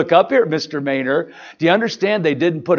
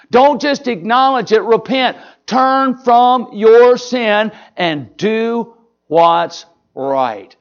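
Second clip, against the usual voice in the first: higher, pleading preacher's cry, slowing on the last words; urgent exhortation; 6 semitones higher, much slower than usual. Real, not acted. Preacher urging fundamentalist congregation to repent, raised urgent call. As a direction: lift it up and beg them to turn.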